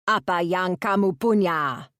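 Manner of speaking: a hint of a curt Indonesian bandit; clipped Indonesian. Bandit questioning the villagers, curt and clipped in Indonesian. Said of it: "The other man's clipped voice".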